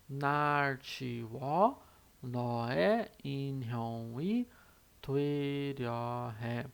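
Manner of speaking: very slow, spaced-out reading of the lyric; reading Korean lyrics. Shown as a demonstration. Teacher slowly reading Fake Love lyrics to students. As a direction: spell the line out word by word. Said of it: "ele fala mais ou menos assim".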